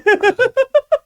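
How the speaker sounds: shrieking burst of laughter; high laugh. Real, not acted. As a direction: cackle loudly. Laugh after retelling.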